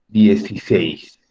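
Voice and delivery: "dieciséis" said slowly and loud, each syllable clear; "dieciséis" drawn out for the class; slow clear demo. Shown as a demonstration. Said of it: "Por ejemplo, miren".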